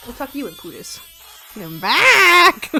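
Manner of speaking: usual speaking voice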